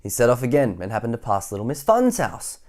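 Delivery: energetic bedtime-story narration; reading to children. A narrator's reading. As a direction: tell it big for little listeners.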